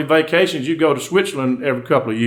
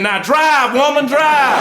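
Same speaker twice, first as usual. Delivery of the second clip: urgent shout; 9 semitones higher, much slower than usual. Acted. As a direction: yell it like you're on fire.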